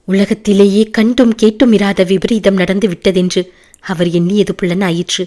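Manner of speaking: ordinary delivery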